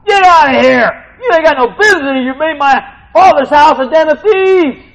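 Jesus clearing the temple, shouting in fury; angry shout. Retold or imitated. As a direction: drive them out with a roar. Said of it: "no, he's like".

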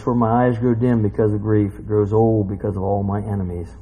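low, even verse reading; sober scripture reading. Acted. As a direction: read the lament out gravely, unhurried. Same verse read out soberly.

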